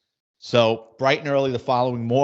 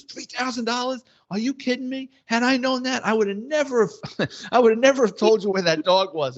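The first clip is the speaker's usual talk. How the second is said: high, squeaky mock outrage; shocked disbelief; 11 semitones higher than usual. Crook hears the dog's price from the cop, high shocked whine. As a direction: imitate the crook's dismay, pitched way up.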